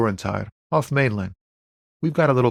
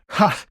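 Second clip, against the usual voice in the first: single high "Ha!"; sudden short burst of laughter; 8 semitones higher than usual. Acted. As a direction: bark out one amused laugh. Joking with the cop.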